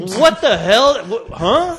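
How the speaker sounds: high, loud, slow incredulous exclamation; baffled outburst. Real, not acted. Co-host baffled by the Halloween trailer, real exasperation. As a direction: burst out loudly, demanding sense.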